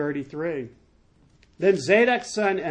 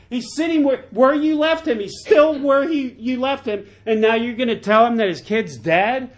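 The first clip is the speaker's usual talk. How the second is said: mock disbelief; 7 semitones higher, 6 dB louder than usual. Acts out disbelief at the runner.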